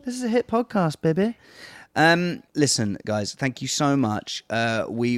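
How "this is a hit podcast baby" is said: put-on high-pitched teasing, as to a toddler; playful mock protest. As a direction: plead the case playfully, up high. Teasing the toddler about the podcast.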